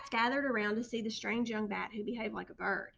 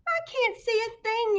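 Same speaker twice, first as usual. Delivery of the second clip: high, loud panicked yell; 12 semitones higher, 7 dB louder than usual. A narrator's reading. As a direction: yell in the dark. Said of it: "yelled Pip".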